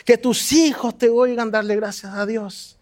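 ordinary talk, nothing marked

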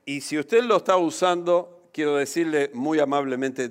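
usual speaking voice

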